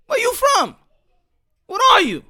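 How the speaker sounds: high, loud mimicry of intrusive questioning; mocking nosy asker. Mimicking strangers pressing him on his origins, high and loud.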